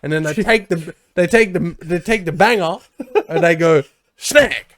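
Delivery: mock Australian accent. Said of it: "we are in Australia".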